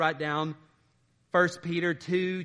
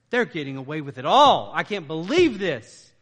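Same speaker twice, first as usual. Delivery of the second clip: louder indignant complaint; mock outrage; 9 dB louder than usual. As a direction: voice their resentment, half-acted. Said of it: "And they're thinking".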